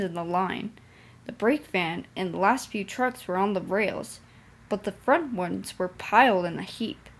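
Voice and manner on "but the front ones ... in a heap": usual speaking voice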